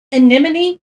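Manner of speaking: anemone as uh-NIM-uh-nee, loud and questioning; corrected pronunciation. Real, not acted. Painter trying a new reading, anemone asked as uh-NEM-uh-nee. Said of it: "It's anemone".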